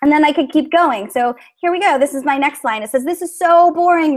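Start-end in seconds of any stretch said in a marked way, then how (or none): 3.0-4.2 mildly hammed-up moan, pitched higher; moaned complaint. A narrator's reading. Teacher reads Ricky's line from her model story, moaning in mock boredom. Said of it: "Ricky moaned".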